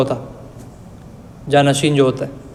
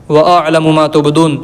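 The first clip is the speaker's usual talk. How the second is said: slow, drawn-out chanted Arabic verse; Quran recitation; slower than usual. Acted. Teacher reciting God's words to students, slow chanted recitation. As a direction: recite it unhurried, like tilawat.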